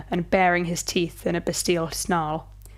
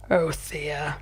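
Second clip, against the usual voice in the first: purring; 3 semitones lower than usual. A narrator's reading. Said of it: "he purred".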